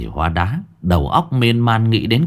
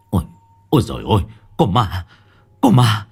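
panicked cry building to a scream; terrified shout; 4 semitones higher, 5 dB louder than usual. A narrator's reading. Husband screaming about a ghost, terrified. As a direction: scream for the village in terror. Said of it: "đột nhiên hét lên một tiếng".

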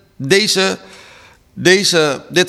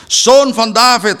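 loud, higher cry of "Zoon van David"; shouting very loud; 6 semitones higher, 5 dB louder than usual. Retold or imitated. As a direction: call out shamelessly across the crowd. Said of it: "keihard, schaamteloos". (luid en schaamteloos roepen)

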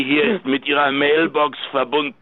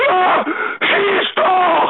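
much higher, slow defiant taunt; taunting dare; much slower than usual. Acted. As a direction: dare them to shoot. Gunman taunts the police.

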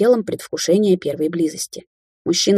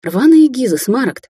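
higher, louder scoffing; scornful snort; 6 semitones higher, 5 dB louder than usual. A narrator's reading. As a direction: scoff at him loudly. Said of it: "фыркнула Рубин".

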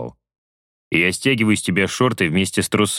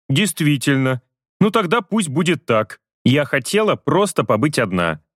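higher, mildly sulky female voice; hurt woman; 7 semitones higher than usual. Acted. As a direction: answer him, a little hurt. Vasya hurt.

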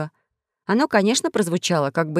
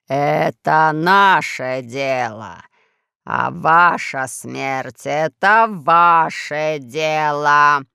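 низкий хриплый, очень медленно; медленно, по слогам, хрипло; low hoarse voice; 4 semitones lower, much slower than usual. A narrator's reading. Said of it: "сказал Пашка". (низким хриплым голосом, по слогам)

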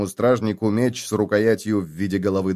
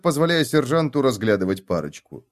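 ordinary delivery

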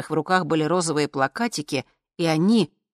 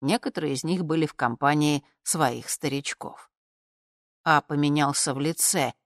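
ordinary delivery

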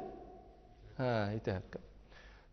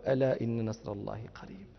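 Quran recitation; 10 semitones lower, 9 dB quieter than usual. Acted.

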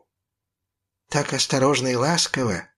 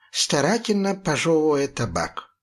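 usual speaking voice

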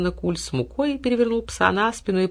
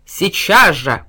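loud demand; 7 dB louder, much slower than usual. A narrator's reading. Bear stamps paw.

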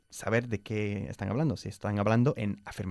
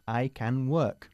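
slow model phrase; much slower than usual. Shown as a demonstration. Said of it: "teacher models slowly".

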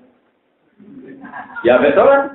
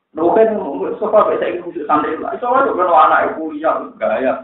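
ordinary talk, nothing marked

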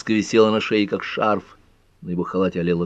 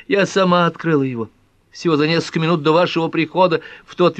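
high-pitched, insistent woman's voice; high, pressing account of the facts; agitated young woman; 9 semitones higher, 4 dB louder than usual. Acted. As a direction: explain it urgently, pitched well above narration. Narrator voices agitated Pat.

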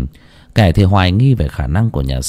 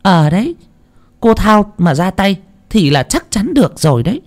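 lightly voiced villager; 11 semitones higher than usual. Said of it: "thì thào".

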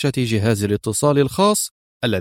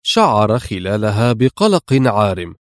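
ordinary delivery, nothing marked

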